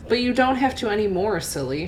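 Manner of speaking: usual speaking voice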